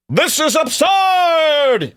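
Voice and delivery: high, stretched-out mocking cry; mock outrage. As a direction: wail it slowly in a high put-on voice. Mimics an outraged reaction.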